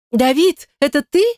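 high-pitched squeal into the phone; high excited squeal; shrill squeal. A narrator's reading. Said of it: "взвизгнула она в трубку".

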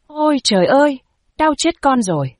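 high-pitched wail of pain; anguished cry. A narrator's reading. Son crushed in his mother's embrace, anguished cry. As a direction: cry out pitifully, pitch leaping up. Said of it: "tiếng ông kêu lên thảm thiết".

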